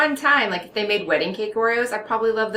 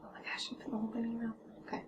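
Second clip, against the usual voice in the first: hushed fast whisper; 19 dB quieter, much faster than usual. Real, not acted. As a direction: whisper it so the camera won't catch it. Watching partner eat whole cookie.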